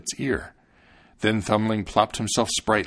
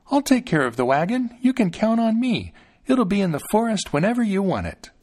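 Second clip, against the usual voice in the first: put-on high-pitched tiny child voice; eager, confident Thumbling; 10 semitones higher than usual. A narrator's reading. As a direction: pipe up confidently as a tiny boy.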